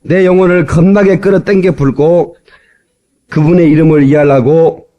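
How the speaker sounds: Jeolla dialect psalm recital; 전라도 사투리 낭송. Shown as a demonstration. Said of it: "전라도 버전을 제가 따왔습니다". (구수한 전라도 사투리로 시편 낭송)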